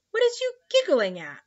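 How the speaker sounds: piqued, with pitch shooting up; slightly nettled. A narrator's reading. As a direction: ask it a bit put out. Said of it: "slightly nettled".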